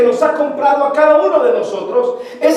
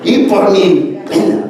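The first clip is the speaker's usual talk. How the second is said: slow, low, hammed-up lament; mock penitent lament; 6 semitones lower than usual. Preacher parodies a breast-beating penitent, slow mock lament. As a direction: drag it out as a mock confession.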